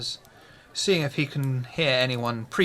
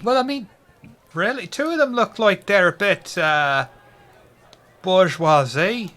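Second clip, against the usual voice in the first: a lightly raised, louder barfly voice; Irish pub regular; 4 semitones higher, 5 dB louder than usual. Acted. GM continues as the friend.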